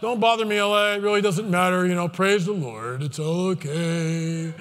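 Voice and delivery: mock sleepy believer brushing off the warning; complacent churchgoer. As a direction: drag it out, unbothered and pious. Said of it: "The church".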